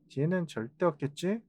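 ordinary delivery

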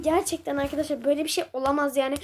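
rushed, fast excited talk. Real, not acted. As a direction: tumble the words out quickly. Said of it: "Çok hızlı konuşuyorum". (heyecandan hızlı hızlı konuşma)

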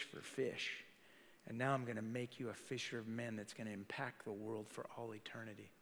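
soft, low, tender comfort; gentle reassurance. Acted. Jesus comforting a kneeling Peter, soft and low. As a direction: speak softly to calm a frightened man.

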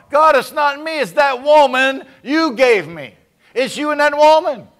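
loud, high, whiny excuse; Adam blaming. Retold or imitated. Adam excuses himself to God, high mock whine. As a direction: shove the blame away in a high wail. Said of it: "You do like Adam in the garden".

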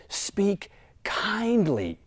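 slow, tender reading of kindly; gentle and slow. Real, not acted. Preacher quoting God's gentle command, slow and tender.

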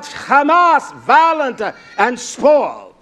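loud, high preacher's cry, words stretched slowly. Acted. Preacher quoting Jeremiah's complaint to Yah, crying out. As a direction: cry it out like an anguished prophet. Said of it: "I cried out".

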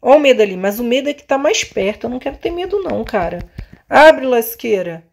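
ordinary talk

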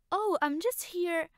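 high, bright-sounding mimic of a carefree girl; mimicked chirpy reply. The inner voices mocking Echo's words to Hera. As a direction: toss it off in a lilting mock voice. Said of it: "Why did you tell her".

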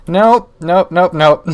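loud playful refusal; emphatic nopes. Real, not acted. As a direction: reject it with rising force. Rejecting item loudly.

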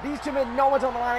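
ordinary delivery

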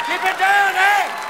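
high, loud Canadian holler; calling out. Acted. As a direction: yell down at noisy neighbours.

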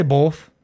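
"both" said the Ohio way; Ohio pronunciation. Shown as a demonstration. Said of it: "That's an Ohio thing".

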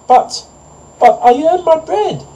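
very high, loud squawk of protest; little hen's high-pitched outcry. A narrator's reading. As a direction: stammer then protest at the top of the range. Hen protests the farmer's ruling.

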